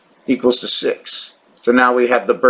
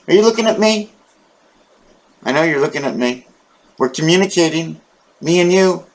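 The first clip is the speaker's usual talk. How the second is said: higher, coaxing voice for the cat; pet talk; 5 semitones higher than usual. Acted. Coaxing his cat to stretch, higher pet talk. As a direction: coax the cat to stretch and open its mouth.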